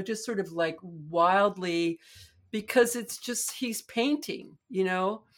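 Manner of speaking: ordinary delivery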